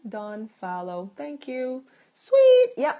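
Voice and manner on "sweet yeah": very high, loud held-out cheer; high-pitched excited holler; celebratory exclamation. Real, not acted. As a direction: sing it out loud and high, pleased. Celebrates completed follow.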